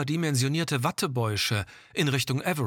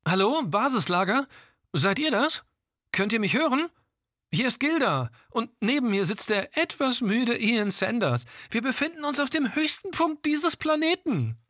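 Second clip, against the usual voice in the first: higher, calling into the radio; over walkie talkie; 11 semitones higher than usual. Acted. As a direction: speak into a crackly walkie talkie. Calling base camp by radio.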